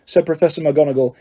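plain reading voice

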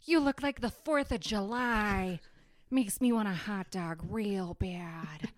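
slow breathy sultry drawl; Jennifer Coolidge impression. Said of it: "my Jennifer Coolidge".

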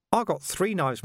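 high, chirpy villain with a laugh; mock innocence, grinning high; cheerful menace, high-pitched; Carcer's voice. A narrator's reading. Carcer's reveal.

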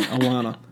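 "Alana" for Atlanta, with the t's dropped; "Alana" as a local Southerner says Atlanta; Southern dialect pronunciation. Shown as a demonstration. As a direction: say "Alana", Southern-style, no t sounds. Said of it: "the appropriate dialect".

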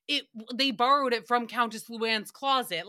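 ordinary talk, nothing marked

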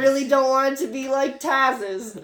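trying out a Brooklyn voice; loud, lightly put-on Brooklyn accent. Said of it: "you're finding Tag's voice".

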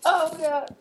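high, loud shocked cry. Acted. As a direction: yelp in shock. Shocked reaction in scene.

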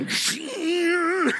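put-on drawn-out call, like a boy on the shore; calling out to Jesus. A boy calls out to Jesus walking on the water. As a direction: call his name across the water.

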